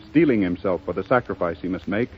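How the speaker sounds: ordinary delivery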